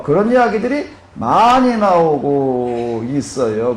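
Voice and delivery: normal talking voice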